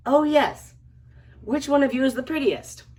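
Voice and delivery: loud call to a crowd of cats. A narrator's reading. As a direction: shout the question as a storybook character. Calling out to the cats.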